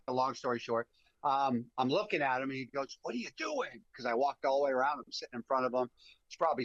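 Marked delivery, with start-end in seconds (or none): 3.0-3.8 higher, softer puzzled question; mimicked friend questioning. Friend in the blind to guide walking around, baffled. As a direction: imitate a baffled buddy, voice up and hushed.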